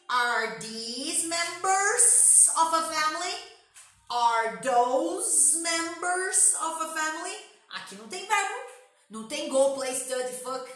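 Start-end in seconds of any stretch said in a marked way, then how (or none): 0.1-7.4 "Are these members of a family?" very slow and loud, rising on "members"; slow drilled question. Shown as a demonstration. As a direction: spell the question out slowly for students. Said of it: "Então, are na frente". (pergunta bem devagar, subindo em "members")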